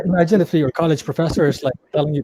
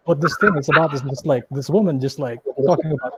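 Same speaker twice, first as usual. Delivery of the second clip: ordinary delivery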